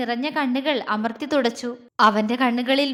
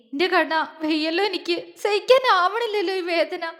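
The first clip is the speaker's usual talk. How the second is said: high, tearful lament rising in pitch; anguished, crying plea; acted sobbing; 5 semitones higher than usual. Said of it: "അവൾ തേങ്ങിപ്പോയി".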